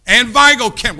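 loud, high shout of the county name; calling out. Real, not acted. Preacher calling out to the congregation, loud shout. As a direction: throw it out to the back row.

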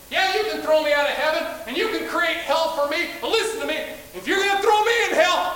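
high, triumphant devil's crowing; gloating devil. Acted. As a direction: gloat at God with shouted laughs. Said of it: "the devil said".

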